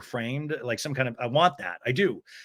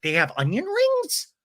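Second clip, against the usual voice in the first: high, amazed question imitating a diner; mock-thrilled disbelief, pitched way up; excited; 10 semitones higher than usual. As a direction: exclaim it high, as retold delighted surprise. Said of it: "People are excited about that".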